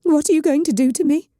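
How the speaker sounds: high, frightened question; a bound man fearfully asking his captor; trembling fear. Acted. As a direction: beg to know your fate. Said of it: "the tremble in his own voice".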